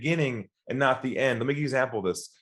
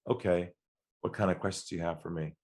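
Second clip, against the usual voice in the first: much softer and lower, a bit fast; relaxed, guard dropped; 7 semitones lower, 8 dB quieter than usual. Retold or imitated. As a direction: caller going human again, soft. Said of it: "He lowers his voice".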